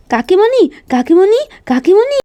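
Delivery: high, breathless calls for auntie; frightened girl calling her aunt; urgent calling. Acted. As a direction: run in and call for help.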